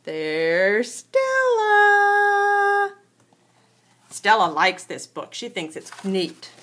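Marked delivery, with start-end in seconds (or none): 0.0-2.9 high, very slow sing-song greeting. Real, not acted. Greets toddler in baby talk.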